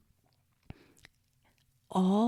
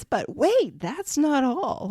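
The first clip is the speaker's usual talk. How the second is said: higher, slowed pitchman reveal; infomercial voice; 5 semitones higher, slower than usual. Pretend. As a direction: sell it like a late-night TV ad. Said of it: "I sound like an infomercial here".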